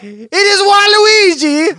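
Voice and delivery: loud, slow, drawn-out announcement; hammed-up shocked exclamation; dramatic reveal. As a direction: unmask the villain with a big slow shout.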